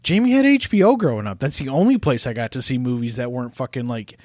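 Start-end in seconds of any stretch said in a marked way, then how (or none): 0.0-1.4 high, loud defensive outburst; indignant exclaim. Real, not acted. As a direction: protest loudly in defense.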